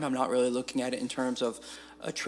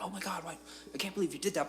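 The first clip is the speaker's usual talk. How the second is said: high, softened imitation of horrified onlookers; mock shocked outcry; 7 semitones higher, 5 dB quieter, much faster than usual. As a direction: lightly act out the group's disbelief. Imitating shocked group after a killing.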